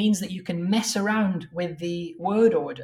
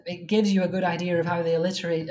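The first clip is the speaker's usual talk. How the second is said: ordinary delivery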